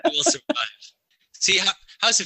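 high laughing cheer. Real, not acted. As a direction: laugh it out triumphantly. Laughing triumph.

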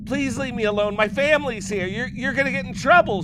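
put-on high, loud frightened girl; scared shout. A narrator's reading. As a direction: shout it out in panic. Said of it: "shouts Devra".

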